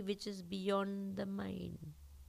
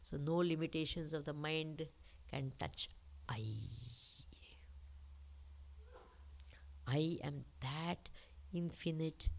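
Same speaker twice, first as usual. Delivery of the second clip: soft, slow, near-whispered truths; hushed meditative voice; 6 dB quieter, slower than usual. Acted. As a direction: draw them inward, hushed. Softens into a meditative teaching.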